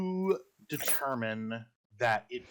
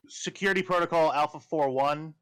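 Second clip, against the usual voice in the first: loud, higher command call; officer barking a security protocol; louder voice; 5 semitones higher, 6 dB louder than usual. Acted. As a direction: call in backup loud and sharp. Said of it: "he calls in sort of a, a louder voice".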